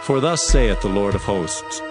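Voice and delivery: usual speaking voice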